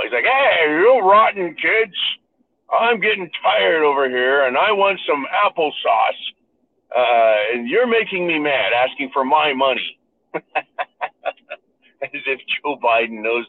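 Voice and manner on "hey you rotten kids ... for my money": hammed-up worn-out old man; tired grandpa. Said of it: "He started acting like tired grandpa".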